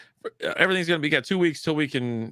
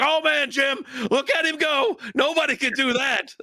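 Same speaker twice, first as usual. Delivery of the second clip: mock a broadcaster losing it over every play; mocking Tony Romo; 13 semitones higher than usual. Host mocking Romo's commentary to his cohost: shrill overexcited gushing. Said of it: "he's screaming at the top of his lungs".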